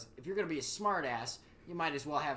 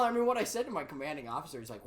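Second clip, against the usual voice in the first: ordinary talk, nothing marked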